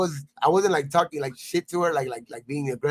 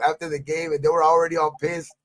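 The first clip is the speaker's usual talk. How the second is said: ordinary talk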